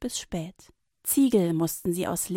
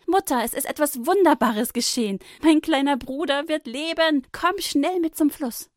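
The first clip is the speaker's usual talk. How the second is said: much higher and louder, bursting with joy; excited; 7 semitones higher, 6 dB louder than usual. A narrator's reading. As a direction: rush in with wonderful news. Miriam runs home with joy. (aufgeregt und überglücklich)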